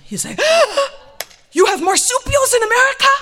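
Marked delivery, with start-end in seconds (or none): 0.4-1.2 loud, high gasp; huge theatrical gasp of surprise; astonished gasp. Retold or imitated. Husband astounded by wife's fact, loud gasp. 1.5-3.1 very high, loud disbelief; astonished outburst. Retold or imitated. Husband astounded by American wildlife, high loud disbelief.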